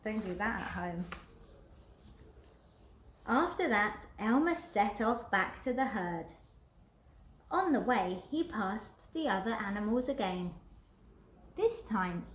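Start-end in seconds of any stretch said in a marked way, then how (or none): none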